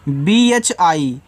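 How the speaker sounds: letters spelled out one by one, very slowly; slow letter-by-letter spelling. Shown as a demonstration. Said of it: "तो बी एच बनाएगा भा".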